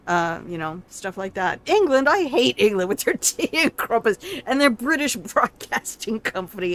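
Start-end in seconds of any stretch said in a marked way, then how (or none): 1.7-6.7 a giggly rant, higher and louder; laughing mock rant. Streamer mocking England to friends, laughing. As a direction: rant about England while cracking up.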